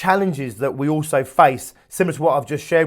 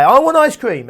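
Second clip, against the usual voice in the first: high, loud mock toddler demand; insistent toddler; 9 semitones higher, 7 dB louder than usual. Toddler presses parent for ice cream, louder and insistent. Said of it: "a toddler will then say".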